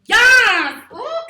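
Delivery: loud, high burst of real excitement; excited yes. Real, not acted. Teacher cheering a student's correct answer, loud and high. Student guesses the right word.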